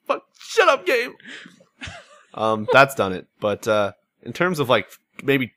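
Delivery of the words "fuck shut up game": high wailing sob-voiced rant at the game; mock crying rant. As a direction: whine it out like you're bawling over the story. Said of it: "I was like".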